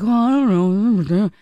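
a lightly mocked politician brushing it off; mock clueless politician. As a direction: play him as breezily unbothered. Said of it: "it's like".

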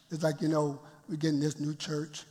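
ordinary talk, nothing marked

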